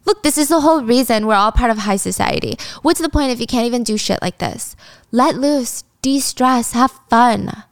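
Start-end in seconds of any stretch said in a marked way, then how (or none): none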